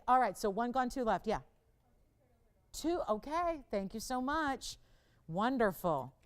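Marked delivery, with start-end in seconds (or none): none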